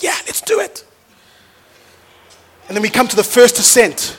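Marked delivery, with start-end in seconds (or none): 0.0-0.8 put-on much higher, eager child's reply; chirpy seven-year-old agreeing; high kid voice, game for it; little girl voice. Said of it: "She's like".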